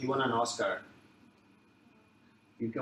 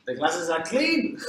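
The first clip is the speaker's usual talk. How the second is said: excited exclaim; 6 semitones higher, 9 dB louder, slower than usual. Real, not acted. Joking exclamation.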